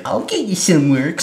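high, slow, silly character voice; Sandy Wexler. Acted. Talent scout promising a job to a client, hammed-up high voice. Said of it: "and Sandy's like".

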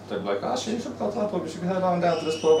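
normal talking voice

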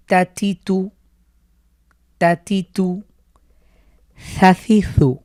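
ثَ ثِ ثُ said as thirty-two, light th with air between tongue and teeth; thin airy th with tongue at the teeth; tongue-between-teeth th sound. Shown as a demonstration. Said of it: "এই সার যে মাখরাজ".

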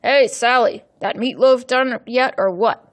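loud yell from another room. Acted. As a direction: bark it at her from the card table. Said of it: "Gabe's voice yelled".

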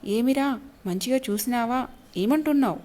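plain reading voice

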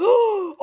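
loud, high startled gasp; a sudden gasp of delight; sharp gasp. Real, not acted. Pulls another EX card.

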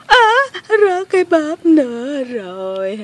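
loud, high cry sliding down slowly; hammed-up wail on dropping something; mock crying wail. Girl who dropped her purse again, loud mock wail. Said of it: "khóc hoài à".

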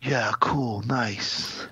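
whispered, vacant yeah, cool, nice; hushed dopey reply; vacant Keanu. Retold or imitated. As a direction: breathe it out blankly like vacant Keanu. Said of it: "Keanu's just like".

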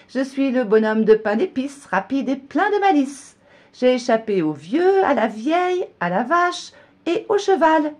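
high, loud boastful rhyme; gingerbread man, a touch scared. A narrator's reading. Frightened gingerbread man bragging to the fox, high and loud. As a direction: brag despite the fear. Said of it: "Effrayé, le bonhomme lui dit".

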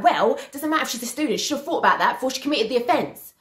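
high-pitched mimicry of an unmoved official; imitated dismissive retort. Bailiff rebuffing a pleading mum, mimicked dismissive retort.

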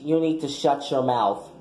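usual speaking voice

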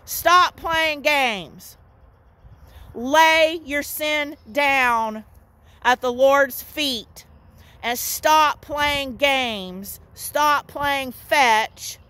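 slow, weighty preaching; preacher urging the saints to stop playing games, slow and firm; slow emphatic exhortation. Real, not acted. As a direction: drive each word home slowly.

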